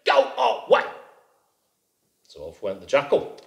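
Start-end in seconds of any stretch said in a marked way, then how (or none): 0.1-0.8 high, shouting, outraged rabbit; indignant rabbit. A narrator's reading. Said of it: "said Rabbit".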